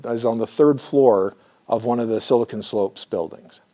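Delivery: ordinary talk, nothing marked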